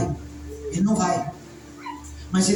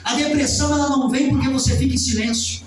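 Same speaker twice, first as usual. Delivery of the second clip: raised pulpit voice, stretching the phrase endings; full-force preaching; 5 semitones higher than usual. Real, not acted. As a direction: drive each phrase home like a fired-up preacher.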